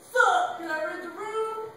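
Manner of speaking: slow, high, loud stoner drawl; laid-back hippie. Acted. Hippie musician greeting the desk lady, slow drawl. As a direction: play a chill surfer dude. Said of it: "hippie musician went in and said".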